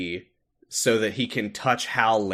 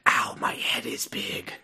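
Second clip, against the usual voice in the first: whimpering whisper; 4 dB quieter than usual. Pretend. Playing headache ASMR roleplay.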